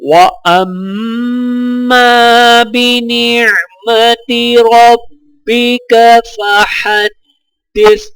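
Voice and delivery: slow, melodic tajweed chant with long drawn vowels; Quran recitation. Acted. Teacher reciting Surah Ad-Duha for students to repeat, melodic chant. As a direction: chant each verse, stretching the endings.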